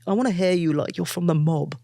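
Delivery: put-on New York mob accent; New York mobster. Drama-school classmates begging the New Yorker, mock mobster accent. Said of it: "you should speak like".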